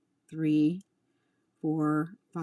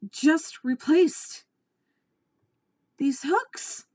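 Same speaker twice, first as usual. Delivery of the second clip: upset; 9 semitones higher, 4 dB louder, slower than usual. Real, not acted. Upset venting.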